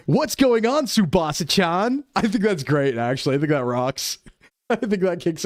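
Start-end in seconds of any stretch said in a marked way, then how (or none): 0.1-2.0 high peppy dub-actor voice; anime dub voice. Retold or imitated. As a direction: play it like a cheesy English dub. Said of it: "really dub style".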